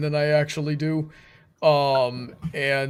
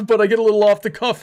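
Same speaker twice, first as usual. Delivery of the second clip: laughing correction; 6 semitones higher, 8 dB louder, faster than usual. Real, not acted. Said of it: "off the cuff is what I meant to say".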